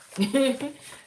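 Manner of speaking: sudden high giggle; burst of laughter. Real, not acted. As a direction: break into a laugh. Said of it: "I'm sorry I'm laughing".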